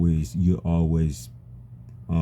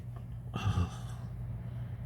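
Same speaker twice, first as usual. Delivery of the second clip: long breathy sigh of frustration; heavy sigh; 16 dB quieter than usual. Real, not acted. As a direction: let out a long defeated sigh. Struggling to say something painful.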